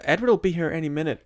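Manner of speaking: trying to scare him off quickly; nervous bluff. Retold or imitated. Bella bluffing to the vampire, quick and high. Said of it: "she's like".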